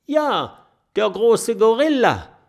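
squeaky emphatic mole. Acted. Mole explaining to the friends about the gorilla.

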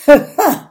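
short, loud, high chuckle; grinning laugh. A narrator's reading. Viking amused by the mockery, grinning laugh. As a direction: burst out in a brief self-satisfied laugh. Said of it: "grinst Gudrun".

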